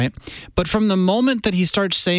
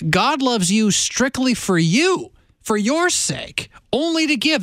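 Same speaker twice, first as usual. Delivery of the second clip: slow and drawn out, higher; slow emphasis; 7 semitones higher, slower than usual. Real, not acted. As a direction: lean on each word slowly, with awe. Emphatic point about God's love.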